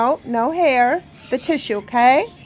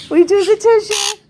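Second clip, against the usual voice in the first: louder, higher insistence on the tissue; firmer repeat; 8 semitones higher, 7 dB louder than usual. Real, not acted. Owner insisting her parrot take the tissue, louder higher repeat. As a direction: raise it up and insist.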